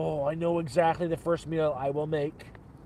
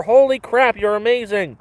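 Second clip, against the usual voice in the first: excited thanks; 5 semitones higher, 7 dB louder than usual. Real, not acted. Thanking a viewer's gift.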